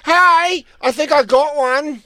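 put-on high, breathy-free girly voice up top. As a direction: squeal it like an excited girl. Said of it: "she goes".

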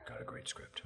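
whispered secretive boast; soft whispered tease; teasing whisper. Retold or imitated. Voicing director teasing about script.

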